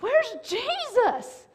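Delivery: high, frantic cries for Jesus; high-pitched panic, drawn out on the first cries; panicked disciples. Retold or imitated. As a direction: act out terrified fishermen searching the boat. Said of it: "they're like".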